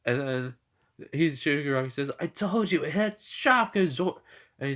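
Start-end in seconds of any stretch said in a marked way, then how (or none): 2.2-4.2 exasperated protest. Retold or imitated. Villain insists his monster is protected, exasperated. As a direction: insist on it in a raised voice.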